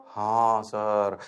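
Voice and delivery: low, soft, drawn-out assent; mock student reply. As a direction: answer slowly as the dutiful class, quietly. Voices students' dutiful reply.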